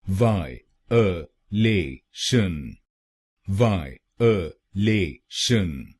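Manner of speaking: वाय अ ले शन said very slowly with a break between each part; syllable by syllable. Shown as a demonstration. Teacher spelling out each syllable, very slow. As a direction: split it into four slow beats, "vaa, uh, le, shan". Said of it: "तो बनेगा violation".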